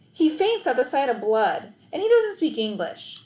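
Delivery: high, hurried excuse; a boy fibbing to cover for the man; flustered kid voice. A narrator's reading. Boy fibs to the vet, high and flustered.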